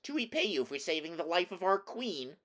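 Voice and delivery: high little mouse voice; high-pitched, eager-to-serve mouse; helpful mouse. A narrator's reading. Big mouse wants to repay the queen's rescuer, high mouse voice. Biggest mouse offers help.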